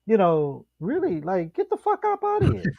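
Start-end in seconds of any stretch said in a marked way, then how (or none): 1.5-2.8 high-pitched, incredulous brush-off; mock-outraged falsetto dismissal; dismissive. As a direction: squeak it out, waving them away. Acting out dismissing ticket checker.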